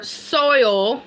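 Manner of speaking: "soil" slowly drawn out with a full oy; deliberate, stretched "soil"; careful pronunciation. Shown as a demonstration. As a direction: sound out "soil" as SOY-ol. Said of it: "did I say it right?".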